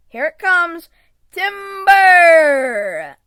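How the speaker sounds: loud, high warning call; booming lumberjack shout; shouting timber. Acted. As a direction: yell it out as the tree falls. Lumberjack calling as tree falls.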